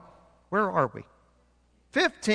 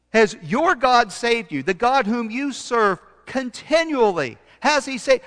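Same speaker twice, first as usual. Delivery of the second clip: urgent king; 7 semitones higher, 5 dB louder than usual. Acted. King's urgent question retold.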